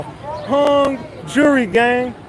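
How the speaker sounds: very slow, stretched-out rallying call; drawn-out slogan. Real, not acted. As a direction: draw each word right out for the chat.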